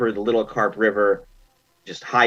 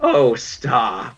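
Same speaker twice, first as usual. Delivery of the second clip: coy crone. Acted. Crone brushes off flattery.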